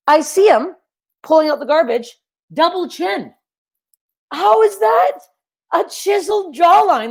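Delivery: building outrage at the claim; incredulous. Real, not acted.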